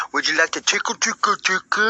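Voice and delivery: high, odd creepy invitation; creepy nerd voice. Retold or imitated. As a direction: lure her in like a weirdo. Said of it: "I go".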